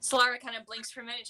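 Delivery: ordinary talk, nothing marked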